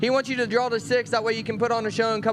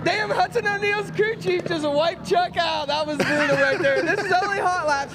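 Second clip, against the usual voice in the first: excited shout; 6 semitones higher than usual. Real, not acted. Reacting to crash in hot laps.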